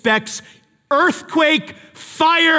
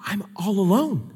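softer, deflated sigh of a line; forlorn; 5 dB quieter than usual. Retold or imitated. Elijah realizing he is alone, softly deflated. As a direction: let the air go out of it. Prophet's sudden despair.

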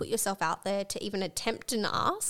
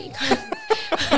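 laughing; 6 dB louder, much slower than usual. Real, not acted. Laughing mid-sentence.

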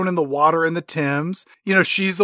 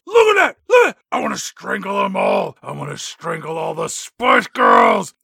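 fit of rage. Acted. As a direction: rant like a raging villain. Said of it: "in a fit of rage".

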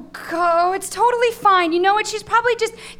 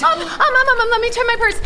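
high, stammering, frantic search; flustered panic; 6 semitones higher, faster than usual. Acted. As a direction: stammer anxiously while hunting for keys. Frantically searching for missing keys.

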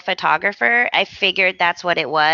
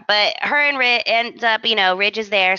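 usual speaking voice

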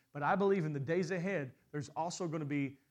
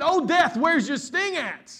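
high, loud taunting jeer at death; mocking death; 10 semitones higher, 12 dB louder, slower than usual. Jesus taunts death, loud mocking cry. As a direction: taunt death like it's already beaten. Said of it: "Jesus mocks death".

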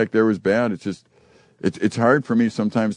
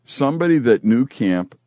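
ordinary talk

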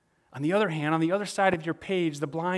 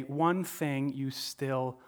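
slow and weighty; 4 semitones lower, much slower than usual. Shown as a demonstration. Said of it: "Why does Jesus say".

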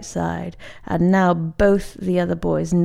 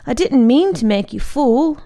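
higher, louder, urgent apology; worried apology; 5 semitones higher, 4 dB louder than usual. A narrator's reading. Monk to the friend who fell, worried apology. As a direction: apologise with raised, anxious voice.

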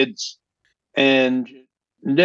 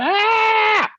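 delighted gasp. Retold or imitated. Singer hearing the twist for the first time, delighted high gasp. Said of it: "she went".

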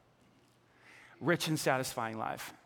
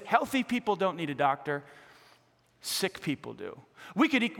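usual speaking voice

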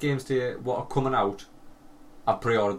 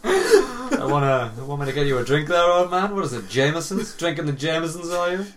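hammed-up come-on to an old man, higher and louder; mock seductive hustler; 5 semitones higher, 3 dB louder than usual. Joking host playing a hustler at a golf club, hammed-up flirt. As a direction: play a cheeky barfly chatting up a pensioner.

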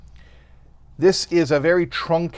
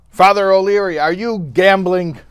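stern police chief; 5 semitones higher, 7 dB louder than usual. Acted. Police chief busts the card game.

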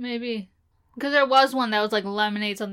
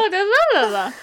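high, loud wordless babble; playful high-pitched gabbling; mock chattering; 9 semitones higher, 6 dB louder than usual. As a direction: imitate someone talking on and on. Mimicking someone chattering on.

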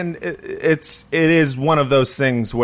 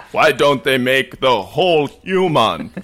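ordinary talk, nothing marked

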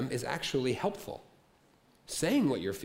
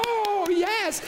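high, loud crowd cheer; a shopper voiced as a cheering crowd, loud and high; mock cheer; 5 dB louder than usual. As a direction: cheer like the whole aisle just won. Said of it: "Everybody's cheering".

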